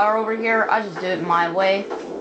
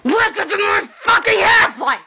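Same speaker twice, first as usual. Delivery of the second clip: shouted show intro; 7 semitones higher than usual. Acted. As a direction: bellow the welcome like a hyped host. Gamer shouting his show intro.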